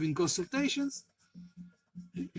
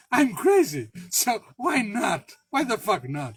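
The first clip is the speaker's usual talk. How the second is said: excited outburst; 4 semitones higher, 7 dB louder than usual. Real, not acted. Laughing at his own recklessness.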